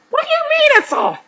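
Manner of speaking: very high, loud screech of protest; outraged bride screeching in falsetto; shriek. A narrator's reading. Jezebel protesting the cancelled wedding, shrieking. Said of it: "Jezebel shrieked".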